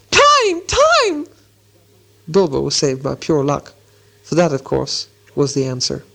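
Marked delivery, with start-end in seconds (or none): 0.1-1.3 very high, strangled squeal; sudden squeal. A narrator's reading. Bilbo's tongue sticks as Gollum comes, panicked squeal. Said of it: "came out with a sudden squeal".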